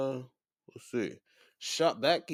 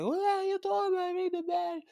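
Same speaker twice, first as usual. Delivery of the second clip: high mock-whiny voice, much higher than own; impatient Becky. Becky imitated pushing the ref to ring the bell, high mock voice. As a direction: nag the ref in a high put-on voice.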